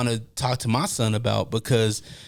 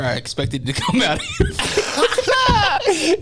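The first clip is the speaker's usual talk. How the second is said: high, slow laugh-shout through words; 4 dB louder, slower than usual. Real, not acted. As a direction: shout it while cracking up. Jokes about water leaking out.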